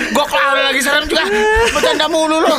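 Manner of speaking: high whiny complaining shout; half-crying mock protest. Friend complains mid ghost story.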